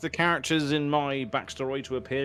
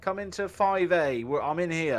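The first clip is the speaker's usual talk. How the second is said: higher-pitched call to lure them over; high, beckoning call; calling out in character; 8 semitones higher than usual. Acted. Player acts calling guards into cell.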